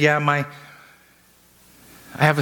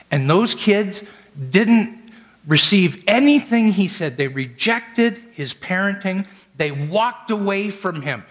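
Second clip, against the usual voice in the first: preaching at full force; 6 semitones higher than usual. Acted. Pastor preaching on air to a grieving caller. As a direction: drive the sermon up to its peak.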